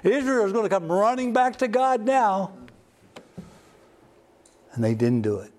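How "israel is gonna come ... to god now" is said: high, eager inner voice; Elijah's hopeful thoughts. Retold or imitated. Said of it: "Elijah in his mind was thinking".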